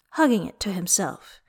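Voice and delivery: ordinary delivery, nothing marked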